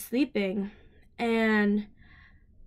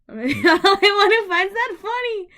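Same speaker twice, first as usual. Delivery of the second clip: laughing; 10 semitones higher, 8 dB louder than usual. Real, not acted. Laughing at own joke.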